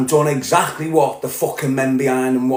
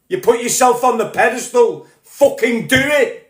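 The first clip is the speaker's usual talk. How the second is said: higher pitched, furious rant building up; real anger; 7 semitones higher than usual. Real, not acted. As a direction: let it burst out, pitch climbing in real anger. Angry rant climaxing at Tommy Robinson.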